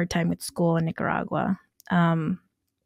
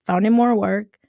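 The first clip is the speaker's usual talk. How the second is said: ordinary talk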